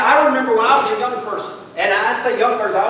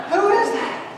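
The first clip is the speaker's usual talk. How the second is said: high-pitched inner question; puzzled aside; 9 semitones higher, 3 dB quieter than usual. Retold or imitated. Pastor privately wondering who greeted him, puzzled high aside. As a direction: drop the volume and squeak it to yourself.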